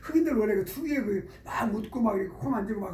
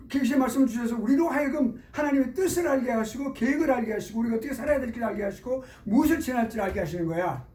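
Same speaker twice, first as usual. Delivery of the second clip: higher, emphatic sermon lecture; raised preaching; 6 semitones higher than usual. Acted. Pastor lecturing the driver on scripture, in earnest preaching voice. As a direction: press the point home like a preacher.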